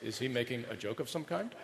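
low, soft, emotionless monotone; deadpan Spock. Retold or imitated. Spocks in the crowd answering the preacher, flat and emotionless. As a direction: speak like a puzzled logical Vulcan. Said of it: "all the Spocks in the crowd are going".

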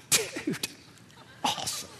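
hushed, pretend amazement; mock awed reaction. Listener reacting to a friend's ten cities, mock awed. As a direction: softly play the impressed buddy.

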